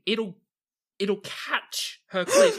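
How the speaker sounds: high, loud, slow horrified exclaim; shocked aside to the group; horrified realization. Real, not acted. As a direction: spell out the horror slowly. Horrified realization about gun part.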